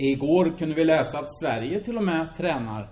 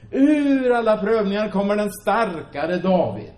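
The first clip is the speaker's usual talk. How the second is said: louder and higher, slow and weighty; fervent preaching; 7 semitones higher, 7 dB louder than usual. Acted. Preacher telling the church of David's trials, fervent and loud. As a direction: drive home the point.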